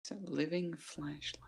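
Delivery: soft unvoiced whisper. Real, not acted. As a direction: breathe it as a whisper. Whispered aside.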